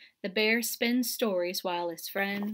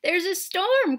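higher, louder worried cry; 9 semitones higher, 6 dB louder than usual. A narrator's reading. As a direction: call it out in alarm. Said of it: "cries Hare".

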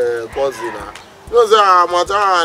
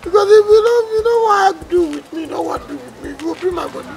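high-pitched lament that drops in volume; high, fretful plaint; distressed wailing; 11 semitones higher than usual. Acted. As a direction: wail it, then fade away. Wailing after friend has left.

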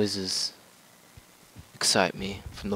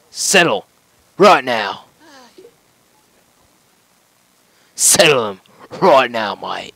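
put-on Aussie accent; 3 semitones higher, 11 dB louder, slower than usual. Gamer ordering a settler, hammed-up Australian shout. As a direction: bark it like an Aussie boss. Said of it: "When did we become Aussie?".

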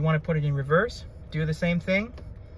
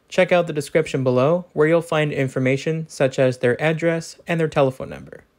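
louder, even-paced dealership ad read; sponsor ad read; 2 semitones lower, 6 dB louder than usual. Acted. Car reviewer credits the dealership that lent the vehicle, in a louder ad-read voice. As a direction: read the sponsor spot like a radio commercial.

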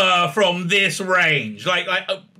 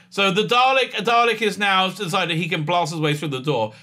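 ordinary talk, nothing marked

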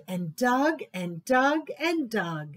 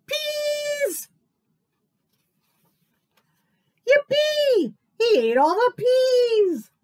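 much higher, loud gleeful cheer; 13 semitones higher, 7 dB louder, slower than usual. Acted. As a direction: cheer that the peas are gone. Gleeful cry.

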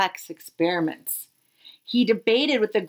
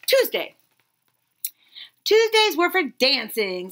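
usual speaking voice

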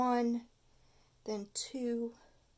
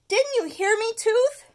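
much higher, louder; angry cry; 12 semitones higher, 10 dB louder than usual. Acted. Stuart yelling at his tooth. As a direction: yell at the tooth. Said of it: "cried Stuart".

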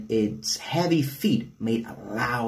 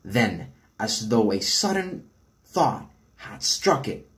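ordinary delivery

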